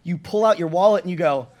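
ordinary talk

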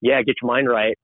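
usual speaking voice

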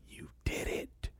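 breathless whisper, barely there; whispered thanks. Retold or imitated. Alone on the street whispering thanks to God, tearful whisper. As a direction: hush it like a private prayer.

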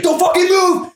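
very high, strained yell; mock-menacing high-pitched shout; threatening order. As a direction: imitate a frantic armed kid barking orders. Sword pointed at child.

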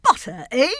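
loud, perky exclamation; delighted king. Acted. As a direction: pounce on it with sudden glee. Delighted king bouncing from bed.

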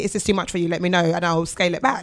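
soft, low, reassuring ask; gentle and soft. Pretend. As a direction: check in tenderly with your partner. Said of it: "I should be able to say".

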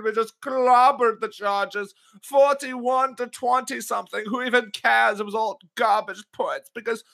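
high, gleeful gloating over the win; gloating excitement. Real, not acted. Patriots fan gloating to his co-hosts, giddy and high. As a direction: crow about the blowout, voice up high.

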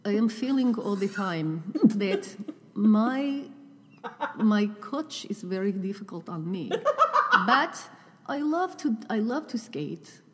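lightly put-on Russian accent, slow; a gentle imitation of a Russian skater's voiceover. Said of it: "a Russian accent".

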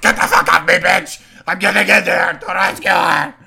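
hammed-up frantic shout, high and loud; imitated crazed outburst at full volume; mock losing it. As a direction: yell like a man possessed. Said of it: "he starts just losing his shit".

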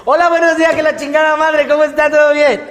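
high, loud greeting padded with filler; padded dub line. Shown as a demonstration. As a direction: stuff in extra words to fill time. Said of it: "Más lento".

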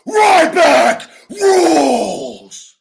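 very high, loud, slow shout of Ryback; roaring catchphrase. Shown as a demonstration. Wrestler showing the catchphrase at full conviction. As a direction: scream it with total belief. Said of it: "What do you believe?".

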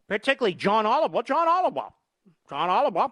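name repeated in a high, silly pitch; mocking name-calling. Real, not acted. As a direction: jeer the name, pitch leaping up. Host mocks the comedian's name.

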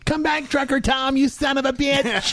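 jokey pretend holler; mock yelling. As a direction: call out like a wronged woman. Mock yelling after trucker.

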